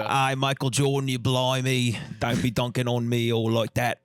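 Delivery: hammed-up British impression; broad fake British accent; mock British accent. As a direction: play a cheeky caricature Brit. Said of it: "he just faked that accent".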